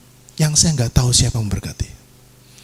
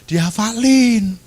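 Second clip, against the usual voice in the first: high, drawn-out command; emphatic retold order; 8 semitones higher, slower than usual. Prankster orders the preacher to memorise, high drawn-out command. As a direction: stretch it out in a higher voice. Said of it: "Dia bilang ini".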